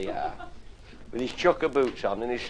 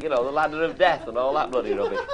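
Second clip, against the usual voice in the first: ordinary talk, nothing marked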